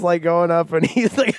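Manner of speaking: normal talking voice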